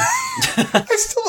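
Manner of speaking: laughing. Real, not acted. Laughing burst mid-sentence.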